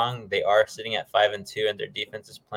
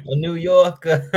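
laughing; 7 semitones higher, 6 dB louder than usual. Real, not acted. Mocking repeat of friend's claim.

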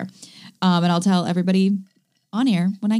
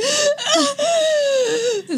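sobbing gasp. Retold or imitated. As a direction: gasp like you just burst into tears. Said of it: "And then I was like".

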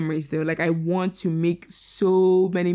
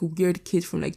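normal talking voice

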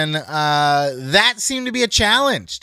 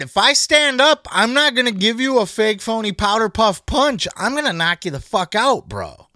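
retold threat; 6 semitones higher than usual. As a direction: hammer it home like a wrestler about to swing. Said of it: "As Waller said".

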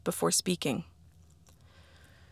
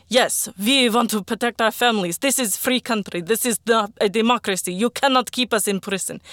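higher, louder protest shout; crowd shouting; 4 semitones higher, 4 dB louder than usual. Acted. Soldiers joining Arkady's protest, angry shouting. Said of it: "I was shouting too".

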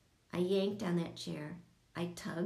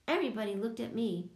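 usual speaking voice

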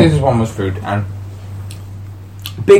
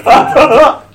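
mock runner's grunts; 14 semitones higher, 5 dB louder, much slower than usual. Mimicking rigid running style.

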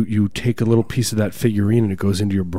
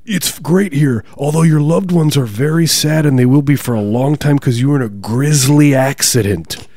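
higher, theatrical otherworldly guide; welcoming afterlife spirit; 5 semitones higher, 5 dB louder than usual. Acted. Spirit telling the victim about loved ones, lofty theatrical voice. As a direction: announce it grandly, as a spirit guide.

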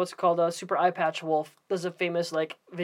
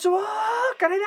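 mock anime yell; 14 semitones higher, faster than usual. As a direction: yell it like an old anime dub. Acting out remembered Akira dub shouts.

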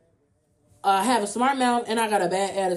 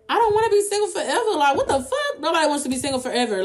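fast, high-pitched outburst; worked-up, rushed exclamation; exasperated protest; 5 semitones higher, faster than usual. Real, not acted. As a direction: blurt it out in comic indignation. Exclaiming against being single forever.

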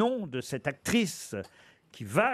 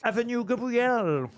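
slow, higher, exaggerated English accent on French; put-on English accent; 5 semitones higher than usual. Host reads the Paris address, mock English accent. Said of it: "je vais utiliser mon meilleur accent anglais".

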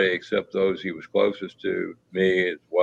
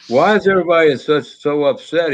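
higher, quick, lightly voiced cheerful brother; brother's puzzled cheer; 7 semitones higher, 3 dB louder than usual. Spirit of late brother asks why everyone's upset, mock-cheerful and higher. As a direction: ask it brightly, like nothing's wrong. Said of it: "he says".

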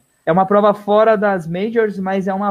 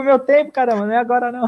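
mock indignant runner, higher voice; annoyed runner bride; 5 semitones higher, faster than usual. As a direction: play the annoyed bride whose race time is ruined, pitched up. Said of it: "eu vi isso aí". (imitando a noiva irritada, voz mais aguda)

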